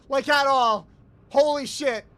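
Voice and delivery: loud, stunned exclamation; shocked disbelief. Real, not acted. Streamer thrown by an unexpected voice, loud shock. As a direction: punch it out, floored.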